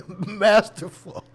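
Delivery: Masterful drawn out slow, high and loud, laughing. Real, not acted. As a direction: stretch Masterful while laughing. Laughing emphatic repeat.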